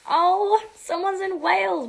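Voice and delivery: high, loud fake gasp of discovery; mock surprise. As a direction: act shocked at the news. Mock surprise, then corrected plainly.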